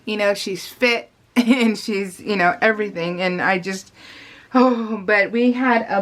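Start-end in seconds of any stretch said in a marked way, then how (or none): none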